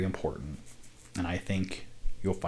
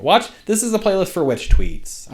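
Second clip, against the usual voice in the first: excited pitch; 12 semitones higher, 11 dB louder than usual. Shown as a demonstration.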